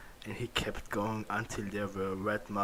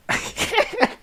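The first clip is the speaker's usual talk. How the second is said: real laugh; 10 dB louder than usual. Real, not acted. Laughing while reading aloud.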